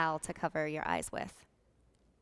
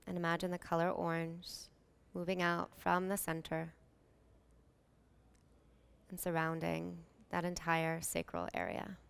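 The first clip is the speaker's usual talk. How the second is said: calm, unhurried meditation voice with long pauses; soothing yoga nidra guidance, evenly paced; guided meditation. Real, not acted.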